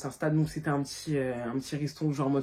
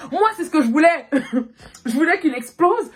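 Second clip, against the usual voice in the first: louder, laughing through the words; laughing gleefully; 7 semitones higher, 6 dB louder than usual. Real, not acted. Telling viewers she wanted him to explode: laughing. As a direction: chuckle with mischief as you admit it. Gleeful about his anger.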